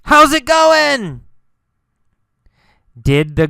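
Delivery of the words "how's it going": high calling-out voice; yelled. Acted. Carlos calling after the girl, yelled. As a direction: holler the greeting, pitched well up. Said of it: "After he yelled".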